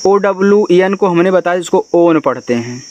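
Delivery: usual speaking voice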